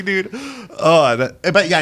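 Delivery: usual speaking voice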